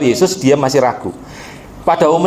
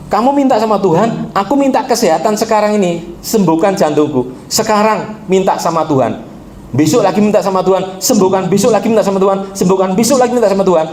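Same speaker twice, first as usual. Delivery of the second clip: raised, ringing exhortation; fiery preaching; 6 semitones higher than usual. Retold or imitated. Pastor urging the sick man to pray, ringing exhortation. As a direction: urge him hard to ask God.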